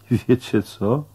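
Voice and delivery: ordinary delivery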